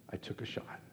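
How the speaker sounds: breathy real whisper; rueful whispered admission. Real, not acted. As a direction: whisper it, half to yourself. Quiet reflection after sad story.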